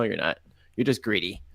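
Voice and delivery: ordinary talk, nothing marked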